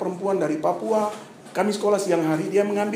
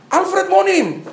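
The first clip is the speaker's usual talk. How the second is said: classmates shout his name, higher and louder; class calling out; 10 semitones higher, 5 dB louder, much slower than usual. Retold or imitated. Classmates shout the latecomer's name to the teacher, calling out. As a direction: call the name out together. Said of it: "Waktu mereka teriak".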